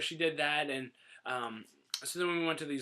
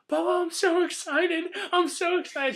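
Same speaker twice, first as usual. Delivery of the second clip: high, loud, gushing mock excitement; put-on high-pitched crying-happy voice; imitated tearful excitement; 6 dB louder than usual. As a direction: squeal it like an overjoyed girlfriend, hammed-up. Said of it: "She actually started crying".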